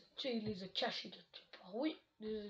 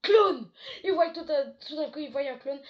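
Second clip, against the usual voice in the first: crié fort et aigu, puis qui retombe; cri de frayeur; 4 semitones higher, 17 dB louder than usual. A narrator's reading. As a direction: comme un sursaut d'effroi qui s'apaise. Le clown apparaît soudain. (crie fort, plus aigu, puis se calme)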